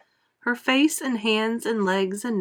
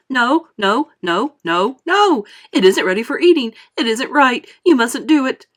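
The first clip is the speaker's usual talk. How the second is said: loud, high, fast pleading; frantic protest; 5 semitones higher, 9 dB louder than usual. A narrator's reading. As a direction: beg them to stop, near panic. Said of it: "wringing his hands and saying".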